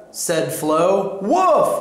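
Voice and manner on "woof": high imitated dog woof; dog's woof. A narrator's reading. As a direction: voice a pet dog's bark, pitched up. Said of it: "said Ebb".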